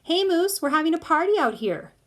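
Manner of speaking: high, loud call through a door. A narrator's reading. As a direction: call him out to the party. Piper calling through bedroom door.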